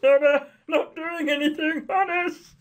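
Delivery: high, put-on nervous babble; hammed-up awkward laugh into a feeble excuse; mock awkward laughter. Player mimicking the heroine caught snooping, hammed-up awkwardness. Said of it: "I laugh awkwardly as I".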